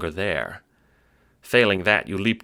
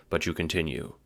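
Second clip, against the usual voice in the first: ordinary delivery, nothing marked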